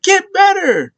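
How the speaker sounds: high-pitched imitation of ringside yelling; mock yelling. Wrestler yelling at opponent from outside the ring, mock yell. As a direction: squawk it way up high, mimicking her. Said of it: "she's yelling at Liv".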